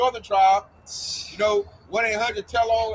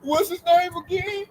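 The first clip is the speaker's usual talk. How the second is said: mock little girl voice; 7 semitones higher than usual. Mocking scared callers as little girls, high whiny. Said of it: "they sound like a bunch of little girls".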